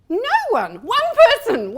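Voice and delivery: high, loud, hammed-up disbelief; mock astonishment. Speaker reacts to audience show of hands, loud mock astonishment. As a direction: exclaim in playful outrage at the turnout.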